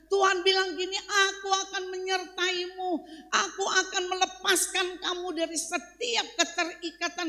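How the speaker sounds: loud, high-pitched sermon exhortation; ringing, forceful preacher's proclamation; full-force preaching. Real, not acted. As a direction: drive it home to the congregation.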